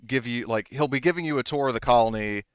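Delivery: ordinary talk, nothing marked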